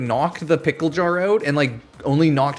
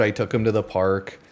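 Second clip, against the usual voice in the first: ordinary talk, nothing marked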